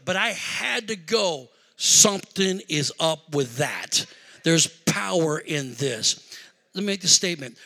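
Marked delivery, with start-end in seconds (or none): 1.8-6.2 slow, low, weighty delivery; measured, awed reflection; solemn realization. Retold or imitated. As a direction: let each word sink in. Solemn realization after punchline.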